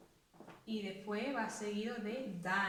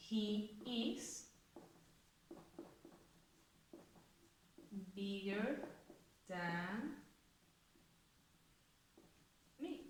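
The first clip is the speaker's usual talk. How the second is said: "He is bigger than me" dicho más suave, palabra a palabra con largas pausas; dictado palabra por palabra; 10 dB quieter, much slower than usual. Shown as a demonstration. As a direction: dicta despacio mientras lo escribe en la pizarra. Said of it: "Vamos a ponerla en una frase". ("He is bigger than me" dictado suave, separando cada palabra)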